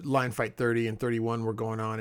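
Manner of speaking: normal talking voice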